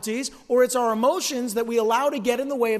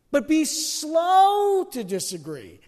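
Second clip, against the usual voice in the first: "slow" drawn out, louder, higher; preacher acting out what "slow" means; slow emphasis; 5 semitones higher, 4 dB louder, much slower than usual. Real, not acted. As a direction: stretch the words to model slowness. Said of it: "drawn out for emphasis".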